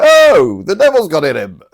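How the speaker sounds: loud, high outcry; hammed-up shocked yell; mock alarm. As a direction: cry out in put-on horror. Voicing the alarmed attackers.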